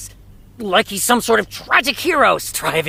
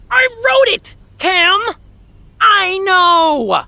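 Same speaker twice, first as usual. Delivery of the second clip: high, louder frustrated cry; fed up, shouting your point across; exasperated outburst; 10 semitones higher, 4 dB louder, slower than usual. Real, not acted. Writer losing patience with friend over his own character, high and loud.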